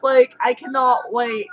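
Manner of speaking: normal talking voice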